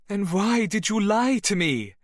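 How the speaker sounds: angry demand. Acted. Bernadette, arms folded, demands why he lied, loud and high. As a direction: throw the question back at him. Bernadette confronts lying lover.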